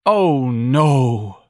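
loud sighing groan, falling away; sighed dismay. A narrator's reading. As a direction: sigh it out in dismay. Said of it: "Missus Levy sighed".

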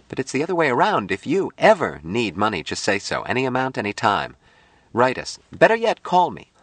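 high, swooping, quick woman's voice; eager Jill character. A narrator's reading. Jill urges Patty to ask for money, eager. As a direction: press the offer on a friend, high and lively.

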